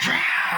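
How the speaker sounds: high, loud mock shriek; imitated monster screech. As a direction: screech like someone turning into a creature.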